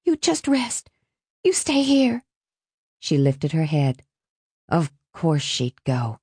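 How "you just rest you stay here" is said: put-on high, light girl's voice; high-pitched young woman consoling; soothing daughter's voice. A narrator's reading. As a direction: reassure Mom in a high voice. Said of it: "Alice was saying".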